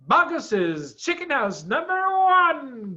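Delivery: high, loud and slow exclamation; triumphant cry; cried out. A narrator's reading. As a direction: announce the prize in delight. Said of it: "cried Mister Fox".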